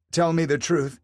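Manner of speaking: wife confronting her cheating husband, demanding. A narrator's reading. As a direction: insist on an answer. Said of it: "Rebecca demanded".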